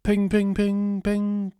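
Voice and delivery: high, ringing mock notification pings; rapid-fire "ping" on one high note; imitated phone pings. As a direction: chime like a phone going off repeatedly.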